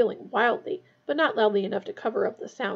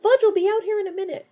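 high, fast, louder warning; urgent warning; 10 semitones higher, 7 dB louder, faster than usual. A narrator's reading. Hermione warns Ron that Fudge is coming, urgent and hurried. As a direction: rush it out urgently. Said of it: "Hermione whispered urgently".

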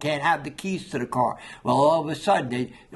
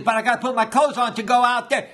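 higher, hurried pleading objection; mock boyish protest; 7 semitones higher, 4 dB louder, faster than usual. Boy objecting to the doctor keeping his clothes, mock protest. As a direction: protest in a quick, raised voice.

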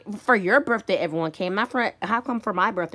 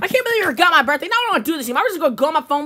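higher, louder, indignant whine; upset complaining; 6 semitones higher, 3 dB louder, much faster than usual. Acted. Birthday kid quitting the party, upset complaining. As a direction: complain hotly and storm off. Storming off over forgotten birthday.

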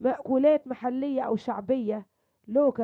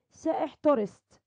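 ordinary delivery

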